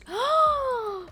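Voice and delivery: sharp high gasp of dismay; shocked gasp. Real, not acted. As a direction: gasp as if hit by bad news. Sim mourning her dad.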